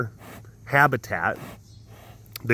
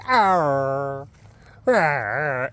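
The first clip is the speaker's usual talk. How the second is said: mild mock tiger "arr"; imitated tiger call. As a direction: lightly growl "arr". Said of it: "I've heard tiger like".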